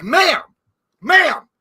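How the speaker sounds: high, loud, sharp call; receptionist calling out. Retold or imitated. Receptionist who didn't know her, loud alarmed call. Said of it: "that receptionist didn't know her. She's like".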